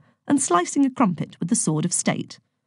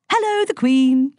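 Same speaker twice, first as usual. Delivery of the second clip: chirpy; 8 semitones higher, 4 dB louder, slower than usual. A narrator's reading. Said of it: "chirped Virgo with a big grin".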